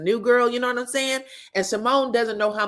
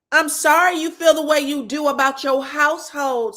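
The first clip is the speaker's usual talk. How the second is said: mock sweet gloat; 7 semitones higher, 6 dB louder than usual. Voicing Heavenly's gloating retort.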